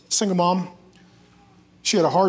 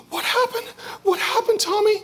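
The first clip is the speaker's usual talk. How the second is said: very high mock-panicked question; alarmed mother. Mom to her crying son Tommy, alarmed. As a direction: fuss in a high squeal like a frightened mom. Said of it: "his mom looks".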